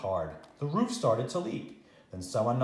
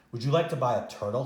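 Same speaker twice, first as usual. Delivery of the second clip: fast, eager sales pitch; quick salesman; faster than usual. Acted. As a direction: rattle it off like a peddler. Pet man's sales pitch.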